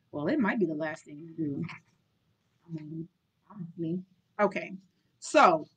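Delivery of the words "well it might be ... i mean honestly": muttered aside. Real, not acted. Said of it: "muttered aside".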